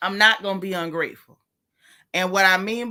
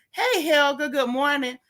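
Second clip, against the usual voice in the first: a put-on proper voice, pitched higher; mock the folks who put on airs; lightly hammed-up polite voice; mock phony churchy greeting; 7 semitones higher than usual. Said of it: "try to talk all phony".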